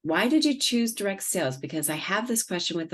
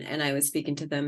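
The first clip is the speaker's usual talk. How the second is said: ordinary talk, nothing marked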